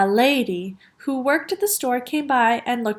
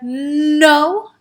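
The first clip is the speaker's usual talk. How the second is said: louder, long-held "nnno"; escalating refusal; 2 semitones higher, 6 dB louder than usual. A narrator's reading. Father refusing more firmly, stubborn. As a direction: build up on the first consonant, rising.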